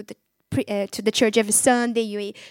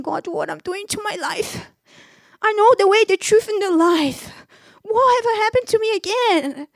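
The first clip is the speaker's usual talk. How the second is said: tearful prayer; 8 semitones higher, 3 dB louder than usual. Retold or imitated. In a prison cell, crying out to God, high and tearful. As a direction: voice rising high and loud in tearful pleading. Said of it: "I just start crying, saying".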